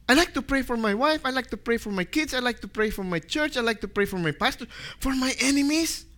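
high, quick listing that rises each time; acted objection with lifted pitch; protesting reluctance. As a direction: plead your case, ending in disbelief. Acts reluctant bargaining with God.

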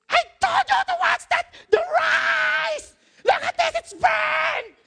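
high, loud scolding yell; shouting at the top of the voice. Retold or imitated. Birthday celebrator scolding over burned rice, shouting at full voice. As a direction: shriek it like an angry cook. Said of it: "shouting at the top of her voice".

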